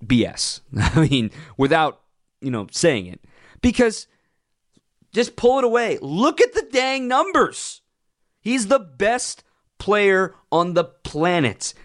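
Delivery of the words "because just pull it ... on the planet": high, slow, heated rant; exasperated rant. Real, not acted. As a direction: make them look at the numbers, all worked up. Ranting about MVP voting.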